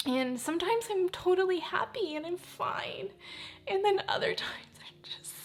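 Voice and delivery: high, tearful voice catching into whispers; real choked-up grief, trying not to cry; fighting back tears. Real, not acted. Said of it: "I just really don't wanna cry".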